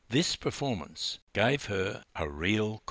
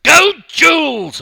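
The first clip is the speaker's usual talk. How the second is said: higher, much louder and slow cheer; 18 dB louder than usual. Acted. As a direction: shout her on as the gun goes. Coach cheering from the stands.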